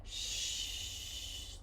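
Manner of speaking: a long drawn, soft sh hiss; voiceless sh. Shown as a demonstration. Teacher demonstrates the voiceless sh sound slowly. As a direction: hold the "sh" with no voice. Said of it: "which is voiceless".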